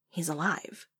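soft, awed statement; hushed revelation. A narrator's reading. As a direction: deliver huge news quietly. Reveals the lost hero lives.

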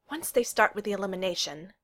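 usual speaking voice

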